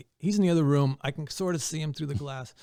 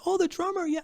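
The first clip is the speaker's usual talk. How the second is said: much higher imitated wife; wife recognizing. The wife recognizing the drummer, much higher imitated voice. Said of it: "He goes".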